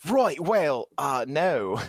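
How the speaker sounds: broad Australian vowels on a reluctant attempt; Australian accent. Shown as a demonstration. Co-host asked for an Australian accent, hammed-up Aussie. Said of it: "could you do an Australian accent?".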